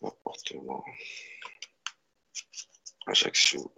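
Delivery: barely voiced, half whispered; murmured to self. Real, not acted. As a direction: mutter the words over the cards. Murmurs over the cards alone. (murmuré pour soi-même)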